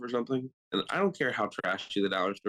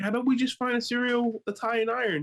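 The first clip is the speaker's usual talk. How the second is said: high-pitched, mock-careful suggestion; placating; 13 semitones higher than usual. Husband placating crying wife in cereal aisle, mock coaxing. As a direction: coax her in a squeaky, pleading tone.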